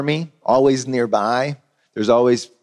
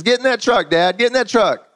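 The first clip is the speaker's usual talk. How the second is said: high, eager urging; eager son urging; 10 semitones higher than usual. Retold or imitated. Son urging his father into the new truck, high and eager. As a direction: push him in with joy.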